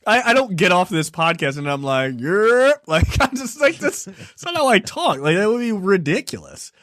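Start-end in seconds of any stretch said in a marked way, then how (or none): none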